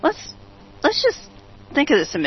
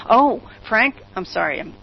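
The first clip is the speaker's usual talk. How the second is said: ordinary talk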